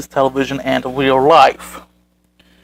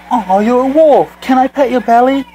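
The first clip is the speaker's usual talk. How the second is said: high, loud cooing over an animal; hammed-up delighted squeal of a question; gushing excitement; 9 semitones higher, 5 dB louder than usual. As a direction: act out an eager would-be belly petter. Acted exchange, eager stranger greeting wolf.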